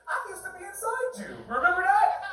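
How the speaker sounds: high-pitched comic confession; blurted inner thought. Acted. Comic saying what he's really thinking to his ex, high-pitched blurt.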